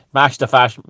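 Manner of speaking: put-on loud, blunt Dublin accent. Comedian trying the slogan in a working-class Dublin accent. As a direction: say it in broad Dublin, punched out. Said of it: "in a Dublin accent".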